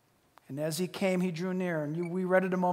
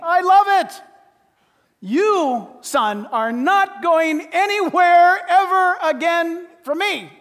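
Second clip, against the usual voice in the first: loud, high, overjoyed father hugging his son; a father who won't let go, loud and slow; excited father; 8 semitones higher, 8 dB louder, slower than usual. Acted. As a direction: bellow it out in delight, slow on the promise. Preacher voicing the embracing father.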